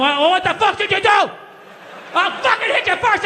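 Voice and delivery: high, slow mock parental yell; imitating a raging father; angry parent. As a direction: bark it like a furious dad. Parent yelling at kid coming home.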